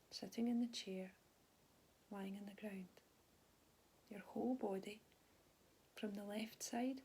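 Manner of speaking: calm, unhurried body scan guidance with long pauses; soothing mindfulness teacher voice; guided meditation. Real, not acted. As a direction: gently guide them through their body, leaving space after each phrase.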